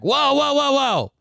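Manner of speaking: high, excited whoa whoa as the keen cop; squeaky, overeager policeman voice; eager cop impression. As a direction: mimic the cop getting hooked, voice jumping up. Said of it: "and the cop's like".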